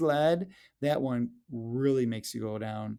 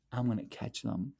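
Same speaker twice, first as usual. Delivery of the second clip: sneaky plan; 3 semitones lower, 8 dB quieter than usual. Acted. Plotting to catch snowmen.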